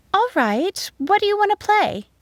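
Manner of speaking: high, lilting schoolteacher voice; high-pitched teacher addressing children; teacher to pupils. A narrator's reading. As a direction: answer the class in a high, agreeable voice. Teacher answers pupils in story.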